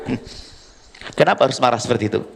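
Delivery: usual speaking voice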